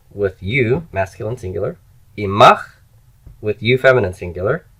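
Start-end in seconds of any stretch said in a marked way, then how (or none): 2.2-2.7 loud, clear model for students; repeat-after-me model. Shown as a demonstration. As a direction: project the word for the class to echo. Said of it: "just repeat after me".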